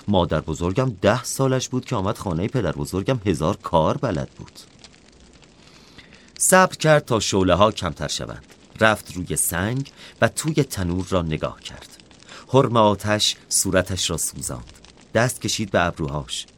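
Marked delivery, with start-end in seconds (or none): none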